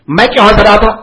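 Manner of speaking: loud, high furious question; angry demand. Retold or imitated. Guru angrily asking the disciple, loud demand. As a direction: fire the question at him.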